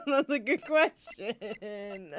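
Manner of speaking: laughing through the words; cracking up mid-line. Real, not acted.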